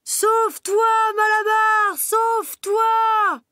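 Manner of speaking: very high, loud, slow desperate shout; shouted in chorus. Acted. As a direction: yell the warning after the van. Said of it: "reprirent son cri". (les animaux crient à Malabar de fuir)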